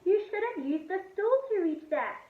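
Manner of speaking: high, loud telling-off; scolding yell. A narrator's reading. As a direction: scold him for the mess. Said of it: "yelled his mum".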